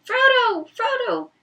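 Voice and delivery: high, loud hobbit calls; shouting. Acted. Hobbits searching the woods, shouting for Frodo. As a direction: call his name into the trees. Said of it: "shouting in their clear, high hobbit voices".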